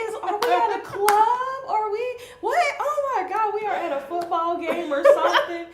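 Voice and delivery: high, loud, laughing astonishment; laughing disbelief. Retold or imitated. Host amazed at the crowd's roar, laughing loudly. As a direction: laugh it out like you can't believe the noise. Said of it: "I was like".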